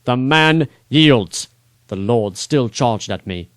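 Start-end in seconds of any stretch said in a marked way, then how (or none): 0.0-1.5 slow, louder firm shout; shouted back. Acted. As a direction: plant your feet and shout it. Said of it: "shouted back".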